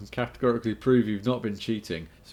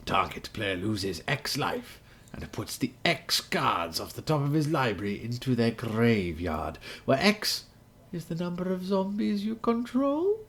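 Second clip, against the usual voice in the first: ancient rasping undead king; 4 semitones higher than usual. Acted. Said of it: "in my Dimir voice".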